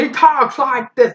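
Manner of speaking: suddenly high-pitched quote; high, emphatic all-caps read. Acted. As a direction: pitch it way up, like reading out capitals. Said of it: "author kinda goes all caps".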